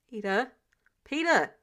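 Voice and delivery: urgent calls, the second a loud shout; calling out. Acted. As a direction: call his name, rising to a shout. Tony calling to dazed Peter.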